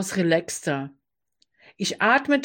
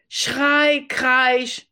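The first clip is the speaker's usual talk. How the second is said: high, loud mock screech; mock shriek; 5 semitones higher, 3 dB louder than usual. Narrator mocking the roommate's shrieking, high and loud. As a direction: imitate the screeching, hammed-up. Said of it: "Hysterie lässt grüßen".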